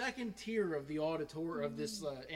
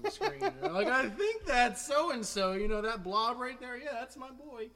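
higher, lightly voiced proud relatives; higher-pitched hint of a proud parent; mock proud family; 9 semitones higher, 8 dB louder than usual. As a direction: imitate family excitedly picking out their boy. Family squinting at blurry webcast.

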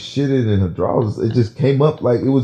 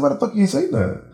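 high-pitched indignant demand; mock-furious questioning, pitched way up; dead mad; faster than usual. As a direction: re-enact an outraged confrontation. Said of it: "was talking like this".

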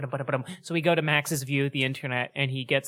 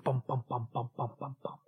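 fading train bumps; 8 dB quieter than usual. Acted.

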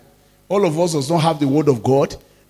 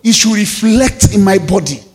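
a louder rising sermon climax; fervent preaching; 3 semitones higher, 5 dB louder than usual. Acted. Pastor exhorts the congregation, louder and fervent. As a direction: drive the point home to the church.

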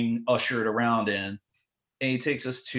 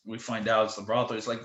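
normal talking voice

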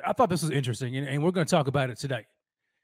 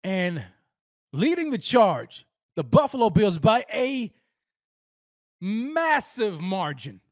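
dramatic reveal; slower than usual. Real, not acted. Vlogger revealing poll leader to viewers, slow dramatic announcing. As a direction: draw out the reveal like a broadcaster.

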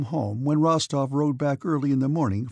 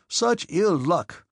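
slow, higher, exasperated cry; emphatic lament; 5 semitones higher, 4 dB louder, much slower than usual. A narrator's reading. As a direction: wail each word out with force. Denisov repeats his lament, stronger.

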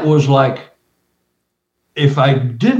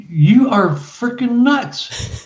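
wife voiced; 8 semitones higher, much slower than usual. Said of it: "she's going".

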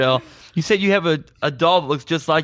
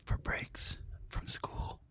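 hushed, slow church praise; whispered mock praise; 6 semitones higher, 12 dB quieter, slower than usual. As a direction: breathe a mock hallelujah. Mock praise for school breaks.

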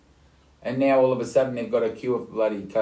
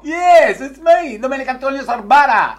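loud introduction; 12 semitones higher, 9 dB louder than usual. Real, not acted. Loud self-introduction on phone call.

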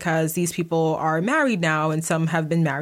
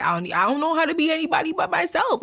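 higher, put-on voice, playfully exasperated; mock exasperated; 10 semitones higher, faster than usual. Telling listeners she can't be fake, mock exasperated. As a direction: toss it off in a squeaky mock whine. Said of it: "I'm like".

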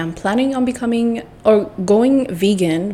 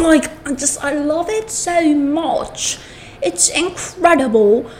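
gushing; 6 semitones higher than usual. Real, not acted. Gushing over favorite restaurant's food.

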